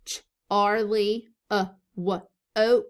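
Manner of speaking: ch arly uh wa oak sounded out in pieces; slow sound-by-sound reading. Shown as a demonstration. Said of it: "it's saying the uh sound".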